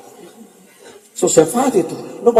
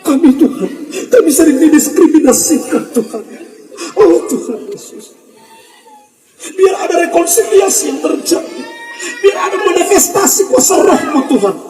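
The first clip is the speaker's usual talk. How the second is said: higher, building to a shout, with laughter in it; hammed-up fervent prayer; 5 semitones higher than usual. Preacher parodying prayers full of showy intonation, shouting.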